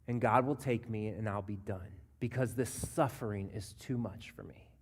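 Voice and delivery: low, sinking voice fading almost to a whisper; weary inner thought. Acted. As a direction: give up quietly, trailing into heavy pauses. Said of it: "I wonder if Naomi is honestly thinking in this moment".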